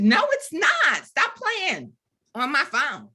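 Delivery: high-pitched, exasperated retort; indignant disbelief. Retold or imitated. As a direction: scold a suspected prank caller. Retelling her disbelief at the caller.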